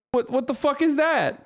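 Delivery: can't believe they banned cats; incredulous outburst. Real, not acted. Streamer reacting to the Russian cat ban with high-pitched disbelief.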